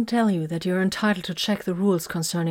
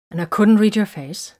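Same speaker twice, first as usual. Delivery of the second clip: louder, pressing accusation; bitter reproach; 3 semitones higher, 7 dB louder than usual. A narrator's reading. Woman telling him she couldn't read him, bitter. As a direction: let the hurt push the voice up. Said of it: "she said bitterly".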